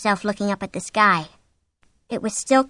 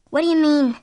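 high, louder, anxious question; frightened; 7 semitones higher, 5 dB louder than usual. A narrator's reading. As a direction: ask it in fright. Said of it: "frightened now".

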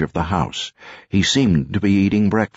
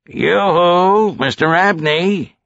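high, louder yoo-hoo call; neighborly singsong call, pitched way up; 13 semitones higher, 4 dB louder, slower than usual. A narrator's reading. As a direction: hail someone through the house. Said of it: "he called out".

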